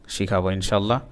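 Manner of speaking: normal talking voice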